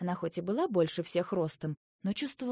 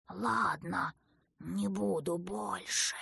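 slow, reluctant snake promise; grudging snake; 4 dB quieter, slower than usual. A narrator's reading. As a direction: give in grudgingly, drawing it out. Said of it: "недовольно пообещал королевский уж".

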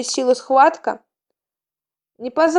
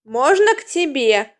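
ordinary delivery, nothing marked

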